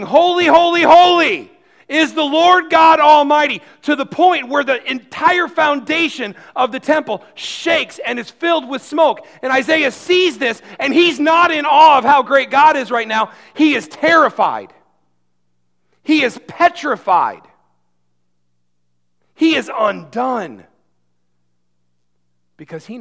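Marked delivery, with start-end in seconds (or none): 0.0-3.6 booming proclamation. Acted. Quoting angels' song, preaching. 3.8-20.6 loud, rising sermon climax; preaching at full force. Acted.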